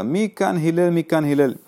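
a caller hunting for Hillel at the door; calling out. Acted. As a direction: call out through the house. Man calls out for Hillel.

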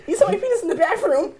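put-on childish tattle. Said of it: "I said".

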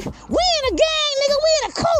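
high squeaky tough-guy dog; boasting little dog. Acted. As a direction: brag in a tiny cartoon squeak. Little pets' gang boast, squeaked.

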